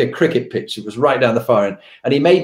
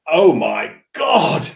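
slow, drawn-out horror, pitched up; retold appalled boss; 4 semitones higher, much slower than usual. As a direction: imitate a boss aghast, stretching each word. Boss reacts to missed lunch.

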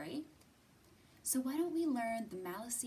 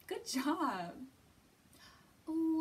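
ordinary delivery

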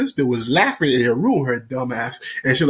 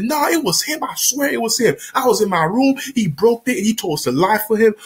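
high defensive protest; 8 semitones higher than usual. Retold or imitated. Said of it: "I said".

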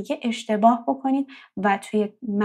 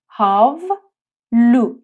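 هاولو stretched very slowly, syllable by syllable; slow syllable demo; 5 dB louder, much slower than usual. Shown as a demonstration. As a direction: drag out هاولو slowly for learners. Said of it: "یعنی حوله". (هاولو آهسته و کشیده)

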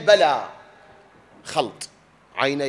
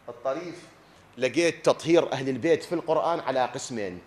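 ordinary talk

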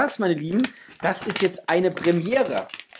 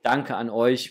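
ordinary delivery

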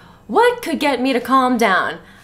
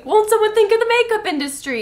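mock outrage; 9 semitones higher, faster than usual.

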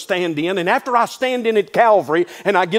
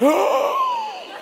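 a big high gasp of the shocked room; loud mock gasp. The shocked congregation gasping at the cut flowers. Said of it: "the room just went".

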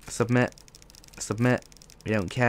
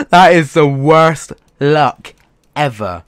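louder, slowed, drawn-out disbelief; slow exasperated outburst; 3 semitones higher, 11 dB louder, slower than usual. Real, not acted. After twenty-nine blues, shouting slow disbelief. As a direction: let the outrage swell slowly. Outraged at drops.

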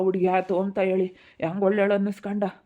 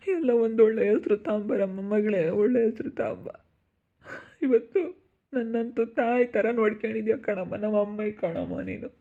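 acted tearful blessing, wailed endings; weepy parent's farewell; crying; 2 semitones higher than usual. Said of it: "ಯಾಕ್ ಅಳ್ತಾ ಇದ್ದೀರಾ?".

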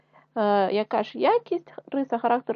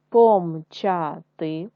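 помчати spelled out slowly, syllable by syllable; slow syllable reading; 3 semitones lower, 5 dB louder, much slower than usual. Shown as a demonstration. Teacher reading помчати to pupils in syllables, slow. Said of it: "читаємо з вами слова".

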